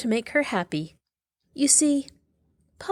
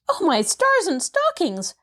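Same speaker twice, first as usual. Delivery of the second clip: much higher shocked exclamation; gasped; 10 semitones higher than usual. Acted. Maid hearing the girl climbed down a tree, gasping. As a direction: gasp in disbelief. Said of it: "gasped Nancy".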